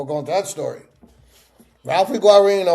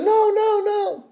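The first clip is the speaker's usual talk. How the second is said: much higher, frightened-sounding chorus of no; crowd denying; much slower than usual. Retold or imitated. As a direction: deny it in a high rush. Crowd denies seeing.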